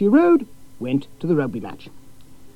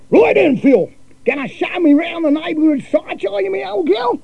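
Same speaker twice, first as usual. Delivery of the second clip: brash Aussie cousin; 4 dB louder than usual. A narrator's reading.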